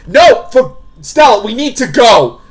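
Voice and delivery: high, loud panicked urging; urgent shout. Acted. Character urging Stella to flee danger, urgent shout. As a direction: shout to get her out now.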